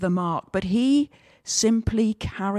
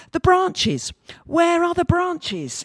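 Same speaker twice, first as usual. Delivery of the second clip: much higher, louder indignant shout; a snubbed donkey yelling for his branches; donkey shouting at the market crowd, high and loud; 10 semitones higher, 3 dB louder, slower than usual. Acted. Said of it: "he shouted".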